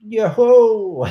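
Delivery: high, loud, drawn-out "ho"; playful holler. Pretend. Co-host joking about wet blanket, playful holler. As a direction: holler it out like a joke.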